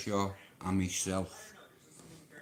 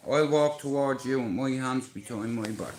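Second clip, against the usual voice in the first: normal talking voice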